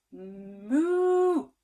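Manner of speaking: loud playful moo; cow moo. Acted. Parent to child, a playful cow moo. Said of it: "Somebody who goes".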